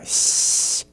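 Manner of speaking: s sound held long and whispered, slow; soft hissed s. Shown as a demonstration. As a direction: stretch out a breathy s. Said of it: "halfway between a sh and a s".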